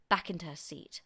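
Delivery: usual speaking voice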